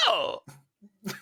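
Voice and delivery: high-pitched squealing okay with a laugh; girlish squeal. Retold or imitated. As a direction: squeal it like a giddy schoolgirl. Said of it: "I'm talking high pitch, high school girl squealing".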